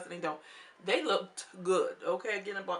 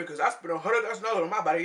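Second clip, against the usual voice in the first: quick, lightly voiced show-off boast; sassy imitation, fast; mock Plastica brag; 3 semitones lower, 3 dB louder, faster than usual. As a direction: rattle it off like a proud diva. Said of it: "She's like".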